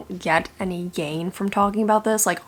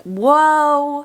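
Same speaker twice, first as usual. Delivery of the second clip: amazed; 6 semitones higher, 5 dB louder than usual. Pretend. Amazed reaction quote.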